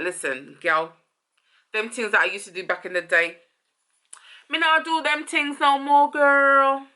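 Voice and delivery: put-on playful patois vow to his girl; Jamaican patois. Voices the rapper vowing to partner.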